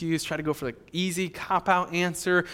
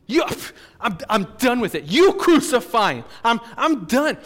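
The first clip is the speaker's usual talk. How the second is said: loud, raised, exasperated refusal; acted governor at the end of his patience; fed-up outburst; 7 semitones higher, 8 dB louder than usual. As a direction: wash your hands of it loudly. Pilate gives up, acted.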